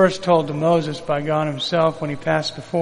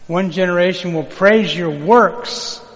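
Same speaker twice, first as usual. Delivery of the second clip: each word of "One generation will praise your works" laid down slowly and louder; slow emphatic reading; 2 semitones higher, 5 dB louder, slower than usual. Shown as a demonstration. Pastor leads the church line by line, slow and emphatic. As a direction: spell it out for them to repeat. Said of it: "Everybody say your works".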